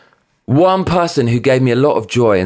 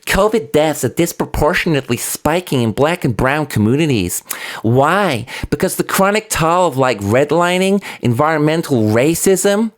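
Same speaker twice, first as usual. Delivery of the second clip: lightly voiced mimicry of the politician, a touch higher; mocking her voice; 3 semitones higher than usual. Vlogger mimicking the congresswoman's tweet, light mock voice. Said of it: "this is how she talks".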